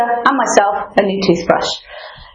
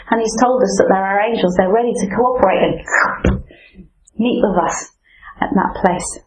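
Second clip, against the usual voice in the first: ordinary talk, nothing marked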